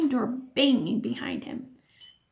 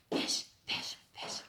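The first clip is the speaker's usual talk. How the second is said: soft unvoiced whisper; 11 dB quieter than usual. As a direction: breathe the words. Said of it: "whispered in his ear".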